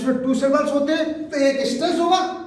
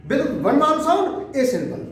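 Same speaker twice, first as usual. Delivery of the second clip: slow dictation; 2 semitones higher, much slower than usual. Shown as a demonstration. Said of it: "teacher dictates slowly".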